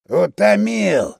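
put-on slow, slurred drunken drawl. A narrator's reading. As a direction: drag it out like a drunk. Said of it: "абсолютно пьяным голосом".

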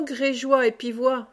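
ordinary delivery, nothing marked

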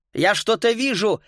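a mild excited cry; calling out. A narrator's reading. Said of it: "крикнул Макс".

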